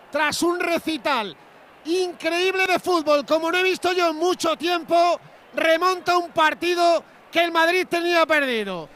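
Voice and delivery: shouted tribute to the player; excited praise. Real, not acted. Shouting praise after the goal.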